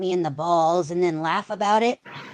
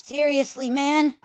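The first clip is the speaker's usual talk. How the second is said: pained disbelief; 8 semitones higher than usual. Acted. Eyes kicked, groin clenched.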